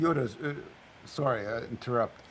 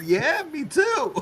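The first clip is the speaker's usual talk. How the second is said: laughing; slower than usual. Real, not acted. Admits same mistake, laughing.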